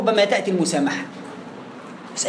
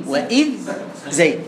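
وإذ held apart by a short stop before زين; sakt pause; 4 semitones lower than usual. Shown as a demonstration. Teacher asking what this is called, pause between words. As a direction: halt on the ذ of وإذ, then say زين. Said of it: "السكت".

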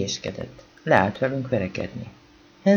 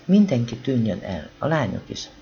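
ordinary delivery, nothing marked

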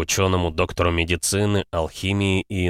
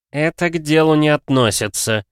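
curt; 8 semitones higher, 5 dB louder than usual. Acted. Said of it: "Алхимик поспешно оборвал".

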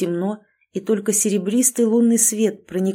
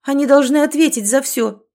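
higher and louder, indignant; indignant protest; 6 semitones higher, 6 dB louder than usual. A narrator's reading. Wife protests indignantly to her husband. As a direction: push back hard, outraged. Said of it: "возмутилась".

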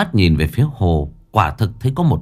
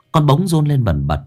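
ordinary delivery, nothing marked

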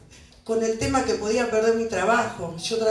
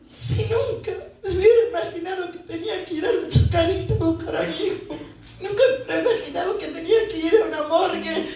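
high, distraught voice; real distress; 8 semitones higher than usual. Real, not acted. Grieving mother recalling the search for her son, distraught. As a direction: pour out anguish, pitched well up.

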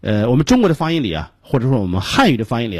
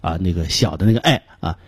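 usual speaking voice

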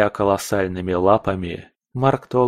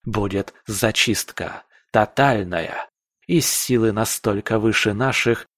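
ordinary delivery

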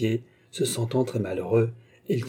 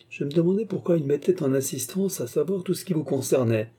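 ordinary delivery, nothing marked

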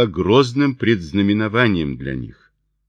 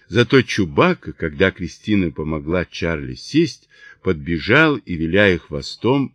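usual speaking voice

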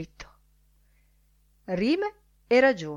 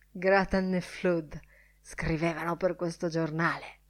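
plain reading voice

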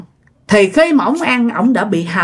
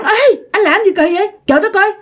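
high-pitched scandalised remonstrance; acted indignant grandmother; protesting to stop him; 6 semitones higher than usual. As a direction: argue him out of it urgently. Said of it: "Cái bà ngoại mới cản".

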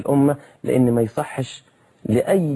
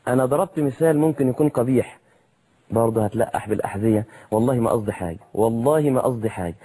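ordinary talk

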